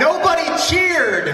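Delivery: high, loud and slow; indignant shout. Acted. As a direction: shout it, mock offended. Mock complaint at silent crowd.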